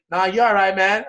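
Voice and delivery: a hint of a casual bystander; retold stranger. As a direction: lightly voice an easygoing guy shrugging it off. Voicing the stranger he bumped.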